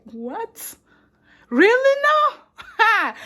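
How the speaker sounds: hammed-up, high-pitched incredulity; shocked disbelief. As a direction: build mock disbelief to a high cry. Acting out first culture shock.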